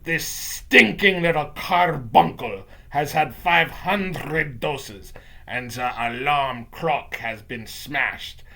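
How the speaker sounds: put-on slow, accented witch declaiming; gloating witch rant, easing from loud to measured; Grand High Witch yelling. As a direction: spit out each phrase slowly as a villain witch. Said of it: "the voice of the Grand High Witch yelling".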